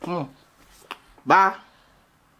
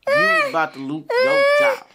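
mock high-pitched scold. Repeated job line, pitch jumps.

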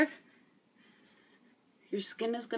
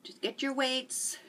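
ordinary delivery

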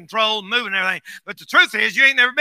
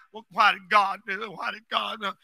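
mock whiny complaint; 3 semitones lower, 8 dB quieter than usual. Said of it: "Don't look at it like".

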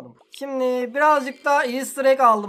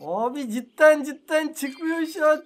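slow, higher, exasperated groan of disbelief; 4 semitones higher, 3 dB louder, slower than usual. Real, not acted. Gamer to viewers, exasperated that nothing hatches. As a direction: drag it out, about to lose it. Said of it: "Çıldıracağım ya".

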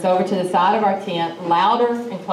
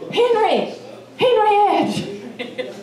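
hollering a name; 13 semitones higher, much slower than usual. Retold or imitated. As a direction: shout his name into the dark, much higher. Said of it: "and I'm hollering".

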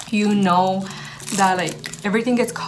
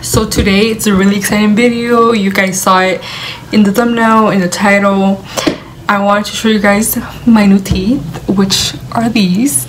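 excited; 11 dB louder than usual. Real, not acted. Excited vlog intro, very loud.

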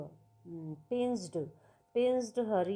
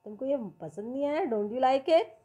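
lightly voiced creatures, higher; a touch of playful sea creatures; teasing creatures; 4 semitones higher, 5 dB louder, much faster than usual. Teacher reading the crabs' words, lightly higher.

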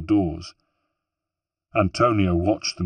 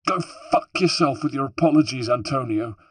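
higher, a yelp of pain and rage; 6 semitones higher than usual. Acted. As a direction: lash out while in agony. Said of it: "yelped Rosa".

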